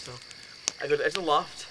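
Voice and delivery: ordinary talk, nothing marked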